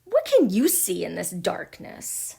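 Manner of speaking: loud, swooping taunt; jeering. A narrator's reading. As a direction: scoff loudly at the very idea. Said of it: "jeered Ch".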